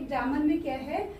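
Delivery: usual speaking voice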